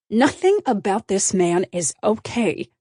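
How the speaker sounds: ordinary delivery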